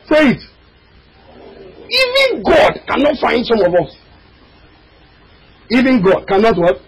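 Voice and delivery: normal talking voice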